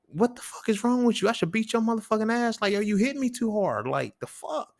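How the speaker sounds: high-pitched protest, as if just hit too hard; indignant outburst. Retold or imitated. Man protesting to a girl who hit him, indignant.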